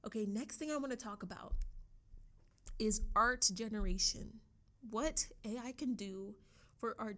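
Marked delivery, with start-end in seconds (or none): none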